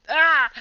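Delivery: loud high yelp of fright; startled yelp. Real, not acted. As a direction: yelp while swatting. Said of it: "I can't stand spiders".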